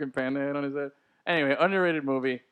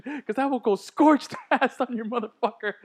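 laughing Grossman quote; 6 semitones higher than usual. Retold or imitated. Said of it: "he goes".

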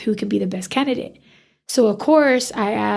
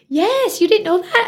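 amused client; 10 semitones higher than usual. Retold or imitated. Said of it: "And she goes".

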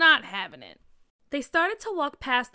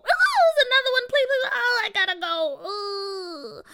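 high, loud frightened squeal; 10 semitones higher, 7 dB louder than usual. Acted. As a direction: squeal and cling in panic. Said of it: "McKinley squealed".

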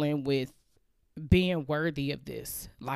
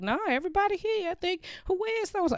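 much higher, fast anxious headcount; staff counting heads; faster than usual. Retold or imitated. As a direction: rattle off the roll call like a jittery coworker. Staff counting who is present.

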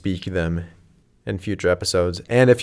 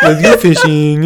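slow, higher mocking impression; Mark Zuckerberg; 4 semitones higher, 5 dB louder, slower than usual. Mocking a friend's impression, slow frat tech-boss voice. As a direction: mimic a tech founder in a frat. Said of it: "if Mark Zuckerberg was in a frat".

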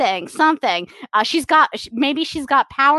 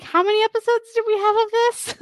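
baffled network; 10 semitones higher than usual. Retold or imitated. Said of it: "The C W is like".

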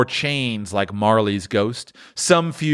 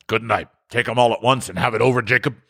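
hurried; 2 semitones higher, faster than usual. Acted. Scrooge hurrying to be done.

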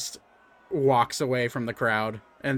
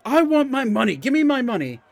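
heckling crowd; 9 semitones higher, 5 dB louder than usual. Acted. Crowd yelling for money.

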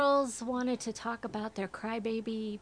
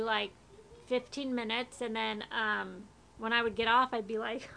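ordinary delivery

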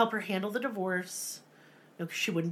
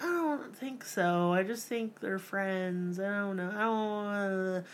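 timid little man; 4 dB quieter than usual. Retold or imitated. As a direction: mumble it like a meek father. Timid father voiced in story.

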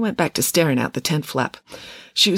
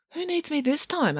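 put-on high, soft questioning girl; wary query; 13 semitones higher, 5 dB quieter than usual. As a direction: ask softly, pitched up. Friday answers from her daze.

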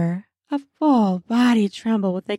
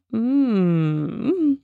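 a hint of a savoring hum; moan while chewing. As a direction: hum with mouth full. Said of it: "I moan as I chew".

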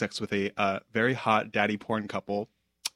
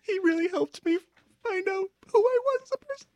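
fake crying. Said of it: "Try to cry if you can".

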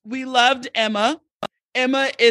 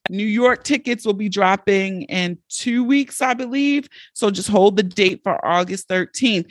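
normal talking voice

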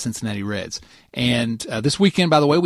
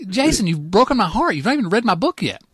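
higher-pitched playful guilt trip; mock heartbroken reproach; 8 semitones higher than usual. Author guilt-tripping his buddy over unread book, mock hurt. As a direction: lay on a hammed-up hurt.

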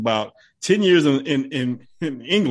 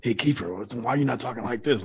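hushed, lowered action-hero voice; soft, intense tough-guy murmur; demanding low voice; 3 semitones lower, 8 dB quieter than usual. Retold or imitated. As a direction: drop it soft and low like a TV agent. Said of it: "you gotta talk to me like this".

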